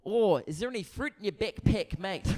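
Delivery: put-on Kiwi accent; New Zealand accent. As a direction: ask casually in a broad New Zealand accent. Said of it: "Excuse the accent".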